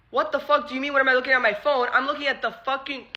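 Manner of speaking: mock indignant yelling, rushing at the end; retold screaming. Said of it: "I'm screaming".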